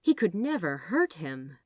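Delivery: plain reading voice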